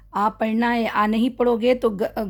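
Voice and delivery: ordinary delivery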